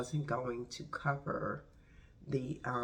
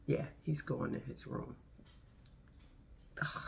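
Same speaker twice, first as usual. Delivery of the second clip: ordinary talk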